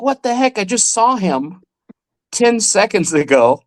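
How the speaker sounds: high-pitched amazement, slowing down; retold disbelief. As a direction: start high, then stretch it out in disbelief. Retelling his amazement at the trick.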